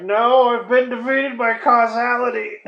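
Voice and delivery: high, loud, drawn-out mock cry of defeat; defeated villain wail. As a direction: wail it like a hammy movie villain foiled.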